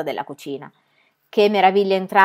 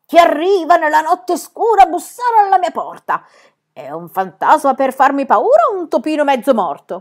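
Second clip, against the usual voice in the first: loud, raised spooky challenge; theatrical haunting voice, pitched up; gloomy, menacing voice; 8 semitones higher, 9 dB louder than usual. A narrator's reading. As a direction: boom it out like a spook behind a door. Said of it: "una voce tenebrosa".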